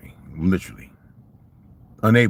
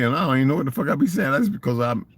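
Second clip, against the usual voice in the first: normal talking voice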